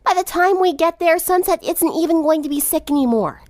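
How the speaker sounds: put-on high, exasperated girl; fed-up friend hurrying the driver; a touch of impatience, lightly voiced; impatient tomboy. A narrator's reading. Impatient Rainbow in the car.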